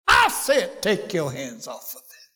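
preacher rebuking the devil; shouted rebuke. Pretend. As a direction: shout it, then bring it down firm. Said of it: "you say".

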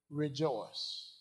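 ordinary talk

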